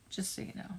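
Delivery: whispered aside. Real, not acted. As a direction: lean in and tell them privately.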